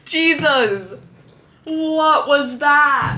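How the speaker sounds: loud startled shout. Real, not acted. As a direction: jump at a sudden bang. Startled by sudden noise.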